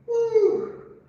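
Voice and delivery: high whooping cheer; excited woo. Real, not acted. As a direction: let out a happy whoop. Cheering after holding the pose.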